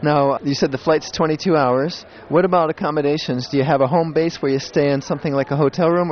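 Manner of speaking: ordinary talk, nothing marked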